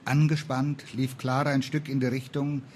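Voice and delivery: ordinary delivery, nothing marked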